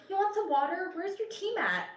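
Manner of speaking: put-on high, sweet older woman saying honey; sugary high lady's voice; doorstep lady voice. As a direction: coo it like a kindly neighbor at her door. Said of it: "She's like".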